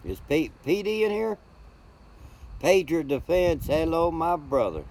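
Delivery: light up when he spots him; excited greeting. Real, not acted. Greeting a viewer in chat.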